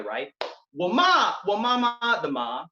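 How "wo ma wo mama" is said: Wo ma, wo mama with each ma in its own tone; Chinese tones demo. Shown as a demonstration. Poet showing the audience a full Chinese sentence in tones. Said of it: "let's put it all together".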